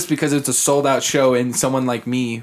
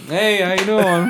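awkward breezy greeting; 7 semitones higher, slower than usual. Retold or imitated. Everyone stares at him.

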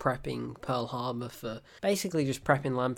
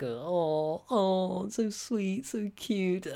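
mock gushing; 4 semitones higher, much slower than usual. Reviewer mocking sweet love letters, mock gushing. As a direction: coo as if it's adorable.

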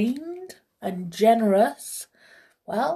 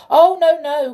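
high, loud cry of protest; 11 semitones higher, 13 dB louder than usual. Acted. King refusing the flute player's order, crying out. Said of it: "cried the king".

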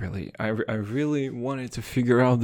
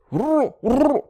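high, loud mock growl; playful growl; 7 dB louder than usual. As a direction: growl like a beast. Growl toward son.

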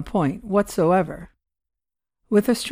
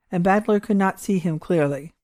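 ordinary delivery, nothing marked

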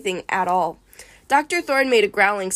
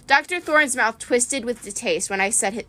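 plain reading voice

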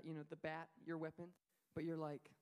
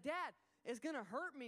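higher, louder, pleading; scared son; 6 semitones higher, 4 dB louder than usual. Retold or imitated. Son fearing the fire will hurt him, pleading. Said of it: "he's like".

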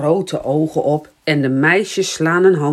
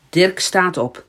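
ordinary delivery, nothing marked

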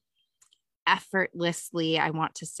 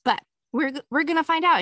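excited; 9 semitones higher, 5 dB louder, faster than usual. Real, not acted. Excited about the coming journey.

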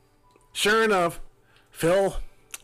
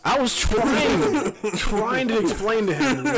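laughing; 8 semitones higher, 5 dB louder, slower than usual. Real, not acted. Laughing while retelling.

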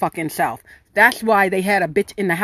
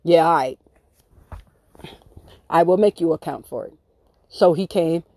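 ordinary talk, nothing marked

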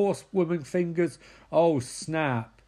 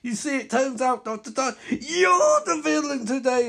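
higher, louder villain reveal; spring the twist with glee; villain telling the hero he is the bad guy; gloating villain; 7 semitones higher, 4 dB louder than usual. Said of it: "Reverse Flash speech".

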